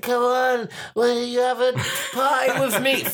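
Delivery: put-on high, slurring drunk voice; mock drunk party invite; drunk woman. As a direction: ham up a tipsy stranger begging for company. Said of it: "one of those drunks that are just like".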